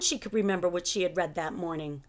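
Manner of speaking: ordinary delivery, nothing marked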